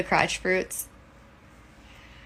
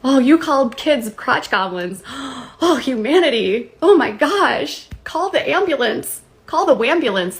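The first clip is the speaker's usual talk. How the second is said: hammed-up dismay, pitch swooping high; mock outrage; 5 semitones higher, 4 dB louder than usual. Mocking those offended by a slang word, hammed-up horror.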